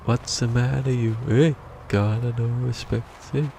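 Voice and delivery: a hint of a cheeky wise guy; mock tough-guy taunt. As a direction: needle someone like a little wise guy. Mocking taunt, repeated in voices.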